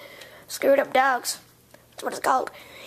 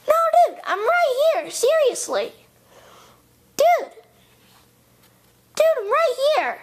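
high, insistent womanly voice; woman's voice; 8 semitones higher than usual. Acted. Clone insists to Yanni it is really Indy, in a woman's voice. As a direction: protest in a high-pitched woman's voice. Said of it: "sound like a woman's voice".

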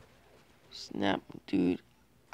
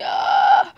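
panicked scream; 14 dB louder than usual. Real, not acted. Gamer about to die.

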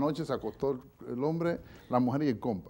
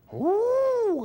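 sudden high loud yelp; 7 dB louder than usual. Acted. Driver hit by the flat tire in rain, high yelp. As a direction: yelp like bad luck just hit.

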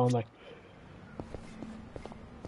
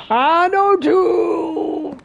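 high startled cry; 13 semitones higher, much slower than usual. Real, not acted. Dart lands on two.

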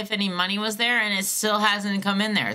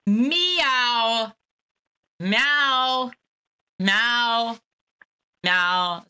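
high repeated meowing; cat meows; 4 semitones higher, much slower than usual. Pretend. Caller repeating cat meows on hold. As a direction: meow over and over like a cat. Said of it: "it's like that, right".

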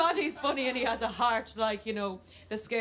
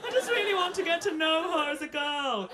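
high, hammed-up whimper; mock frightened men; 7 semitones higher than usual. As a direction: plead as if hiding in terror. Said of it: "the men are in the loo hiding".

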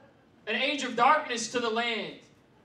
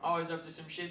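usual speaking voice

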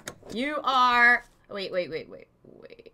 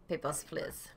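ordinary talk

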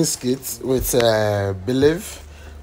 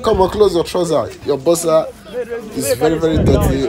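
yelling at friend; 7 semitones higher, 6 dB louder than usual. Retold or imitated. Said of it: "I said".

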